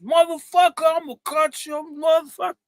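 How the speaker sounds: high, loud threatening shout; street fight yell. Retold or imitated. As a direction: yell it like a brawl on the corner.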